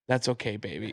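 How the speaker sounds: put-on soft, low and tender, like comforting a child; gentle reassurance. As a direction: murmur it kindly to soothe him. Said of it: "she just gave me the nicest".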